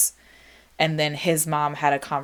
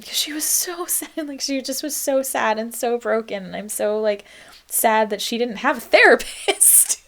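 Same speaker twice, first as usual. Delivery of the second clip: laughing and crying at once, much higher; real tears; 7 semitones higher than usual. Real, not acted. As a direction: choke up, breaking into a laugh-cry. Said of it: "my heart breaks right now".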